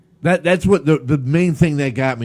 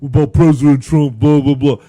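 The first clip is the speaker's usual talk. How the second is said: mocking whiny guy; 2 semitones lower, 6 dB louder than usual. Mocking the man's complaint.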